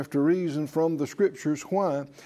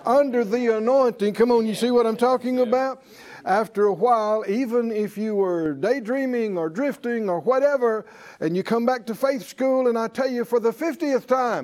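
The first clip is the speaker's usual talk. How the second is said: higher, louder fervent preaching; preaching at full force; 5 semitones higher, 3 dB louder than usual. Acted. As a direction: build the fire in the room.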